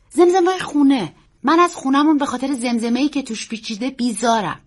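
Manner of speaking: put-on high, loud child's voice; upset boy. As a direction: vent as a distressed boy. Boy vents about house.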